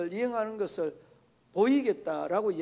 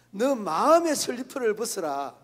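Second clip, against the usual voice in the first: louder, indignant rebuke; angry retort; 4 semitones higher, 7 dB louder than usual. Retold or imitated. Colleague scolds the evangelist about slippers, loud and indignant. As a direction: snap back at the critic, raising the voice. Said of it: "막 노발대발하면서 막 큰 소리 치는 거".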